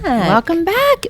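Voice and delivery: high, slow sing-song echo. Acted. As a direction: stretch it out high and playful. Echoing cohost playfully.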